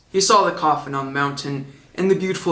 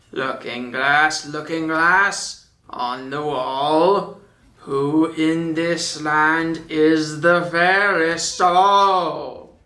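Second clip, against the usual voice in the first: slow, chanted plea to the mirror; queen asking the mirror, slow; queen's incantation; much slower than usual. A narrator's reading. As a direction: intone it like a spell. Queen asks the magic mirror.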